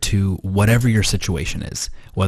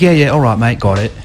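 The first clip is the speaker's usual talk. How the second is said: put-on shitty British accent; 3 semitones higher, 3 dB louder than usual. Confronted assistant answers his boss, badly imitated British accent. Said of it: "it's my shitty accent".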